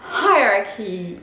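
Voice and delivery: hierarchy with H sounded and ch as K; English hierarchy. Shown as a demonstration. Teacher repeating hierarchy for students. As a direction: say hierarchy as hai-rar-ki. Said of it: "le H est bien prononcé".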